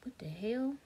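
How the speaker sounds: breathless unvoiced whisper of disbelief; whispered what the hell. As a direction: whisper it, stunned. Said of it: "And I was like".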